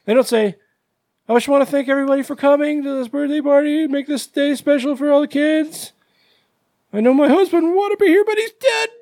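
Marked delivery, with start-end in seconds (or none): 1.3-8.9 high, rushed party announcement as a hammed-up widow; mock tearful widow. Widow thanking party guests for coming, hammed-up high wail. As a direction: play an over-emotional hostess, pitch climbing to the end.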